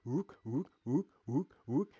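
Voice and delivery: looping "rook" without the r, lightly French; a light mock French "rook", rising each time; imitating French streamer. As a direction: mimic a French guy saying "rook" over and over. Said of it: "a video of him going".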